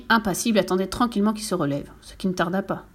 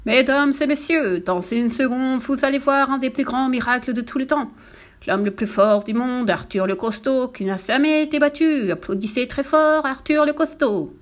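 annonce de piste, lente et appuyée, plus haut; Présente l'homme fort comme un monsieur Loyal; bonimenteur de cirque; 4 semitones higher than usual. Acted. Directeur de cirque annonce le numéro. (monsieur Loyal qui harangue le public, lent et sonore)

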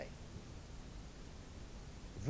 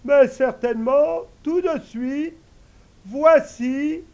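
much higher, louder eager servant voice; invisible servant; 11 semitones higher, 13 dB louder, slower than usual. Acted. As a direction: answer the master high and ready. Servant obliges.

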